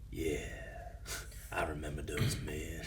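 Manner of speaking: put-on low voice, quoting the show; Mandalorian guy impression. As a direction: drop low and soft to imitate him. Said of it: "The guy from Mandalorian?".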